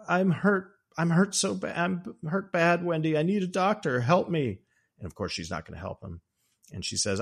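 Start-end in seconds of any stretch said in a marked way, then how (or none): 0.1-4.6 much higher whiny fake-pain plea; milk false injury for sympathy; a hint of a pathetic, wounded husband; faking hurt. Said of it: "And then he goes to".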